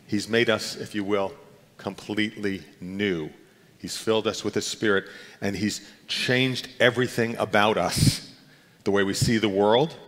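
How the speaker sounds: usual speaking voice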